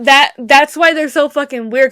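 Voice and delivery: normal talking voice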